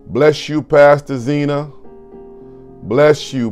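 normal talking voice

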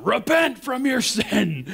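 slow, high, loud hellfire preacher; mock preacher. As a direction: ham up the stern street preacher.

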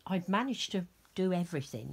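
normal talking voice

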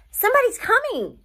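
high, loud call across the clearing; yelled out. Acted. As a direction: call out the warning to the shop. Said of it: "I yelled out".

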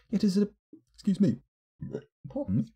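hiccuping and burping mid-sentence; hiccups and burps. Real, not acted. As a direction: read through hiccups and burps. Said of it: "hiccuping and burping at the same time".

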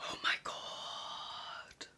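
whispered very slowly, close to tears; awed whisper. Real, not acted. Vlogger sees her dream bag, tearful whisper. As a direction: let it out as a tearful breath.

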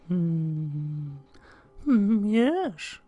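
high, slow, creepy whisper-like voice; creepy childlike high voice; Salad Fingers voice. Pretend. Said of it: "Salad finger voice".

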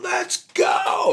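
high, yelled rally cry; imitated shout. Men shoving others at the Capitol steps, shouting. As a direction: bark at the men to push forward.

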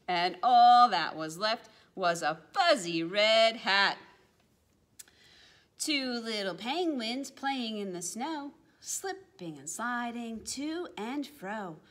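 ordinary delivery

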